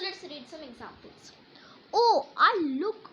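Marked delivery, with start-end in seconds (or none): 1.9-3.1 high, loud, excited cry of surprise; excited exclamation. Shown as a demonstration. Teacher showing pupils an exclamation, excited. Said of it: "See, how you don't have to read".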